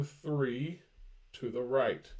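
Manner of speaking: stretched slow walk-through; slow step-by-step. Shown as a demonstration. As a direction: count it out slowly for students. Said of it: "slow demonstration on number line".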